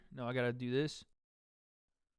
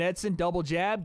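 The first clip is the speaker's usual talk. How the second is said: ordinary talk, nothing marked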